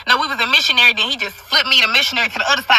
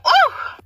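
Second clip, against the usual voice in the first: high mock moan. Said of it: "I'm like".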